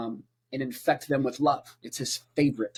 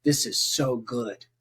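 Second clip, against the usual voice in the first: slow, amazed murmur; a hint of awestruck wonder, slow; lightly voiced marvelling god; 5 semitones lower, slower than usual. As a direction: savour it like a proud creator. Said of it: "he was like".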